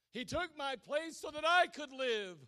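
loud, high fervent preaching; preaching at full force. Acted. Preacher building to a fervent climax, loud. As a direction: drive it home to the congregation.

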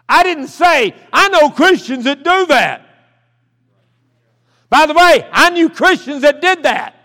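high, loud, emphatic preacher delivery; forceful preaching. Real, not acted. Preacher explaining his prayer to the congregation, forceful preaching. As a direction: drive the point home, slow and loud on the key claim.

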